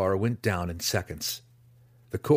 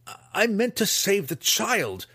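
stammered protest; 12 semitones higher, 3 dB louder than usual. Acted. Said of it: "Drizzt argued".

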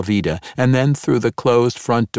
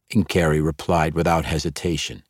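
usual speaking voice

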